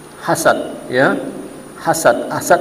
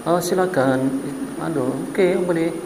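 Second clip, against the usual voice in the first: much softer limp surrender; mock meek; 9 dB quieter than usual. Husband meekly hands his wife to the flirting man, much softer. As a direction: shrug and give in feebly.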